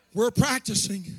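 normal talking voice

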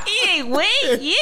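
high, laughing mimicry; mock them in a high silly voice. Teasing co-host by mimicking their put-on voice, mocking. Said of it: "mocking someone or mimicking someone".

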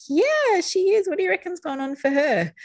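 very high, sing-out agreement; parent agreeing. Pretend. Parent agreeing with child about judgy granny, high-pitched knowing agreement. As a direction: start way up high, then ease down into the question. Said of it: "we can be like".

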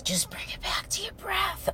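soft whispered yoga cue. Shown as a demonstration. Guiding listeners through yoga, a soft whisper. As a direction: breathe it out like a calm instructor.

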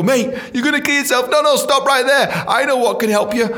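high-pitched, frantic mock plea; urgent intervention. Friend stopping someone about to kill himself, mock urgent. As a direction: jump in to stop a friend, acted high and urgent.

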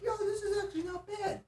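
very high, light retold thought; put-on high voice, pleasantly surprised; pleased surprise. Telling friends his first thought on the water, pleasantly surprised.

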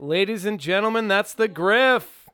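booming slow announcer intro. Pretend. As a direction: present him like a ring announcer. Announces the Griff's entrance.

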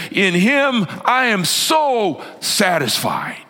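high, emphatic heavenly proclamation; proud thunderous God. Acted. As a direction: announce overflowing delight to the whole world. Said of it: "The Father says to the world".

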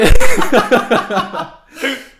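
big burst of laughter; loud laugh. Real, not acted. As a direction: laugh out hard. Co-host laughs at joke.

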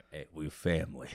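low raspy Vin Diesel voice; deep gravel tough guy. Pretend. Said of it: "his voice is like a mixture between deep and just really fucking raspy".

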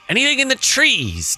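high appeal. Acted. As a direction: ask hopefully, pitched well up. Player playfully appeals to game master.

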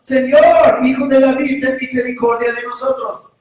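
loud, high desperate shout. Acted. Blind men shouting over the rebuking crowd, desperate. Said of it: "gritaron aún más fuerte".

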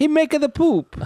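high-pitched broken-English Italian; Italian accent. Acted. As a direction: ham it up as a comic Italian. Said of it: "me doing an Ita".

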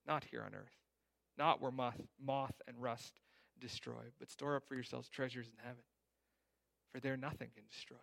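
ordinary talk, nothing marked

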